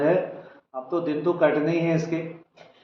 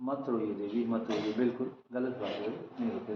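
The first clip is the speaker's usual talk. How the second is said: hushed, tender, much softer; soothing consolation; 6 semitones lower, 13 dB quieter than usual. Real, not acted. Baba softly consoling the weeping caller, tender hush. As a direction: gently hush her tears, close and low.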